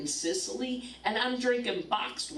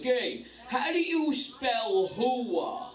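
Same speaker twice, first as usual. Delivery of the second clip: higher, drawn-out Brooklyn voice; Brooklyn accent; 7 semitones higher, much slower than usual. Retold or imitated. Wife mimics husband asking a spelling, in a Brooklyn accent. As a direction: imitate a husband in slow, heavy Brooklynese. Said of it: "that Brooklyn voice".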